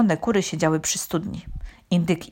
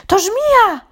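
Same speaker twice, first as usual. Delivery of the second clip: very high, loud scream of alarm; frightened mother cat screaming; terrified shriek; 8 dB louder than usual. A narrator's reading. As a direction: shriek a panicked warning. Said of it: "wrzasnęła przerażona kocia mama".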